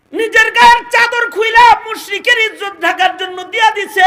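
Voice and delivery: high, shouted sermon; full-force preaching. Real, not acted. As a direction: drive each phrase up to a shout.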